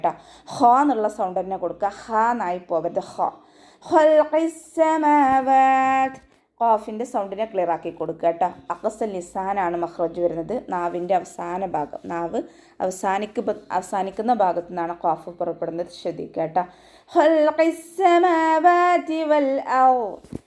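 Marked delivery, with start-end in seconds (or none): 3.8-6.2 slow, high melodic tajweed chant; chanted Quranic verse, drawn out; Quran recitation. Shown as a demonstration. 17.1-20.4 slow, high melodic tajweed chant; chanted Quranic verse, drawn out; Quran recitation. Shown as a demonstration.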